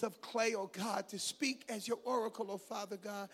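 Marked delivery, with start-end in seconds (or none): none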